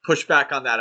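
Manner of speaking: normal talking voice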